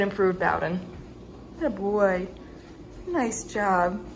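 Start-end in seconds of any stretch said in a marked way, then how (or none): none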